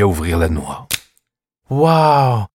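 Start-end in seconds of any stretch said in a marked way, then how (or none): 1.7-2.5 higher, louder gasp of delight; thrilled wow. Acted. Clara marvels at the tale, thrilled and loud. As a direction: marvel like a child. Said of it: "s'extasia Clara".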